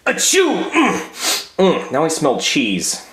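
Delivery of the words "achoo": sneeze. Acted. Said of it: "I have to sneeze".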